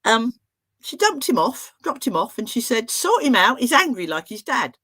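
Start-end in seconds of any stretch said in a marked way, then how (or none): 2.9-4.8 higher, louder, brisk order; brusque retold mum. Mum dumping her boy at therapy, brusque. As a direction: hand him over and dash off.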